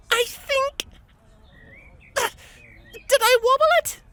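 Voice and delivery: very high, straining filly voice; hopeful squeak. A narrator's reading.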